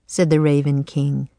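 ordinary delivery, nothing marked

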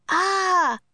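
high, loud startled yell. A narrator's reading. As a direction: scream as the tiger leaps. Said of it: "they yelled".

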